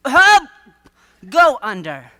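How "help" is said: high, loud yells for help; hammed-up drowning man's cry; mock cry for help. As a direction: shout help like a drowning man. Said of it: "yell for help a few times".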